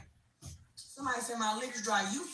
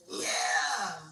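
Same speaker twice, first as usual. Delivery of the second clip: triumphant shout; 8 semitones lower than usual. Real, not acted. Hyped after winning fight.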